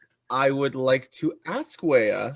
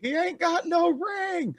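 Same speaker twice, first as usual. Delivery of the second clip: squeaky mock voice. Player mocks the NPC.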